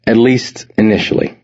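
ordinary delivery

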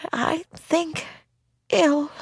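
high, soft, feeble granny voice; bleary ailing grandmother; sick old granny. Acted. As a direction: answer weakly and high, blearily. Bleary sick granny answers doctor.

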